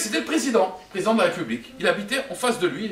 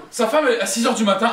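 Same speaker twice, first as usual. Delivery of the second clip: normal talking voice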